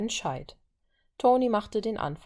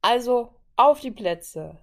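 loud, slow, drawn-out race command; starting call; 5 semitones higher, 8 dB louder, slower than usual. A narrator's reading. Natascha sends the three men to positions, starting call. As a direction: call them to the line, stretched out.

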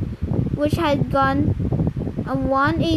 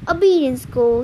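ordinary delivery

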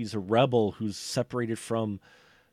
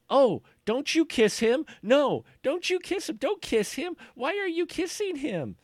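hammed-up much higher pleading voice; mock heroine's protests; 12 semitones higher than usual. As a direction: squeak out the jealous heroine's lines in mockery. Mocking the film's love scene.

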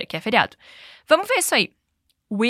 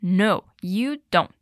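slow, careful No, you don't; drawn-out repeat for learners; slow model again; 3 semitones lower, much slower than usual. Shown as a demonstration. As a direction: stretch No, you don't out so they can copy. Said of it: "Say it again".

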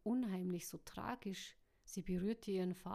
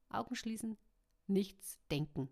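plain reading voice

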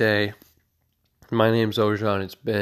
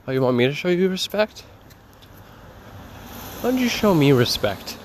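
higher, challenging comeback; indignant retort; 6 semitones higher than usual. Acted. Cat talking back to its owner about respect, indignant. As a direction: throw the demand right back.

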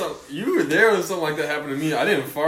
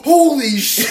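much higher, much louder exclamation; amazed shout; 13 semitones higher, 11 dB louder than usual. Retold or imitated. Onlookers to Buster on a half rep, a loud amazed shout. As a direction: yell in fake awe. People cheering Buster's shallow squat.

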